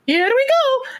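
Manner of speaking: very high, bright "Here we go" like the plumber; Mario impression. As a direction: squeak it out like the game's Mario. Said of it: "he quotes".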